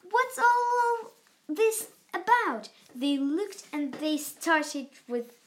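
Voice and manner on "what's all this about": loud, higher excited shout; loud high shouted question; shouting crowd. A narrator's reading. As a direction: crowd calling out in wonder. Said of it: "The people came shouting".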